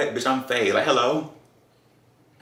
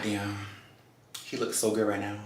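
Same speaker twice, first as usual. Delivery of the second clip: much softer, breathy admiring murmur; quiet aside; 8 dB quieter than usual. Retold or imitated. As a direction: say it under your breath. Thinking to herself about him.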